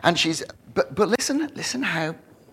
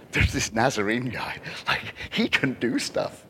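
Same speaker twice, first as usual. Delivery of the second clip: whispered rumor; 5 semitones lower, 6 dB quieter than usual. Acted.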